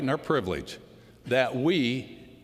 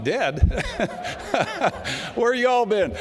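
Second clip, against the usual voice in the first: laughing disbelief, pitched high and louder; incredulous laugh; 9 semitones higher, 4 dB louder, slower than usual. Retold or imitated. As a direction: scoff at the idea, laughing out loud. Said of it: "I'm like".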